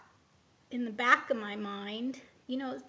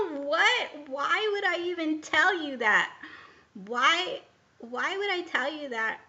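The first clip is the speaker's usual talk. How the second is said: louder, indignant questioning; irritated retort; 4 semitones higher, 7 dB louder than usual. Retold or imitated. Answering the voice in her head, irritated and louder. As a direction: push back, annoyed and raised. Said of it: "I got really frus-, really irritated, really mad".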